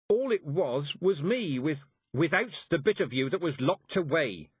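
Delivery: lightly voiced sharp old witch; said sharply. A narrator's reading. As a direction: clip each word like a rebuke. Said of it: "said Mistress Weatherwax sharply".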